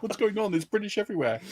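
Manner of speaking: high, hushed mock panic; so confused. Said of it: "he'd be so confused".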